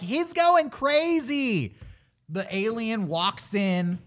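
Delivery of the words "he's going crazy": high, drawn-out cry of alarm; slow, high mock wail. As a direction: imitate frightened kids reporting trouble. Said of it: "They're like".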